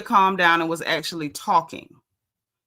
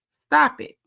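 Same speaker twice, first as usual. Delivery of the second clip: higher, louder stop it; sharp command; 7 semitones higher, 4 dB louder than usual. Real, not acted. Vlogger loudly telling Portia to stop it. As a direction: snap it out as an order.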